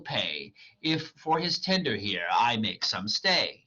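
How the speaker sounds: dramatic reading of verse; reading Shakespeare aloud. Acted. Reader voicing Hermia rejecting Demetrius, dramatic. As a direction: read the lines as the character, measured.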